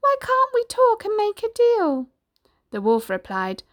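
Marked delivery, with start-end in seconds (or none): 0.0-2.1 very high, pleading piggy squeal; squealing little pig. A narrator's reading. Pig begging the wolf to make a deal, high squeal. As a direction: squeal out a desperate plea. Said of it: "began to squeal. He cried".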